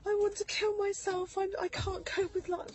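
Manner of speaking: high, loud, tearful plea; sobbing confession, pitched high; crying despair. Retold or imitated. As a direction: voice breaking as she relives it. Retold breakdown to the doctor.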